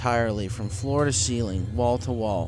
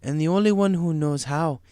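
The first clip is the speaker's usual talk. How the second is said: slow, higher ominous rhyme; Zecora voiced; 5 semitones higher, slower than usual. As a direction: pronounce it gravely.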